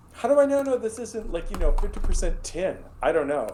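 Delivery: mock suspicious buyer. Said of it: "and you're like".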